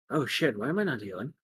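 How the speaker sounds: hushed acted dismay; worried to self. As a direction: fret quickly under the breath. Hero repeats it to himself.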